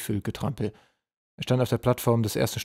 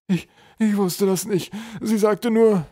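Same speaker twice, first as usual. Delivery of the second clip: high, loud stammering troll; frightened pleading; 11 semitones higher, 7 dB louder, slower than usual. A narrator's reading. Poisoned troll stammers excuses to the general, frightened.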